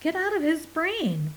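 high, sharp command; one alien ordering the other out. Acted. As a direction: order him out. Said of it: "Portical ordered".

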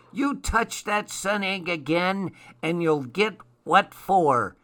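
high-pitched scolding gnome; threatening. A narrator's reading. Gnome threatens Larch, holding his ear.

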